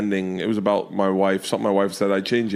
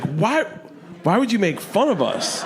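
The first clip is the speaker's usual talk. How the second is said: high-pitched mock protest; imitated indignant daughter; 12 semitones higher than usual. Daughter asks dad why he mocked her, imitated high protest. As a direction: voice a child's wounded complaint, pitched up.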